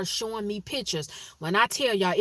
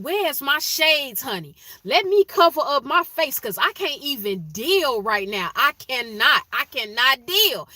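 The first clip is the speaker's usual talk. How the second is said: loud mock dazzled; 5 semitones higher, 6 dB louder than usual. Joking about her bright glow.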